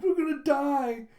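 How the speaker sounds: a drawn-out high panicked cry; panicked wail. Retold or imitated. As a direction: wail it in terror. Terrified on the rapids.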